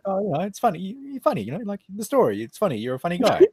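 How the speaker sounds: high, eager voice for the other guy; voicing the other guy. Telling the stream how a guy praised him, high and eager. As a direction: mimic the guy who called him funny. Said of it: "he's like".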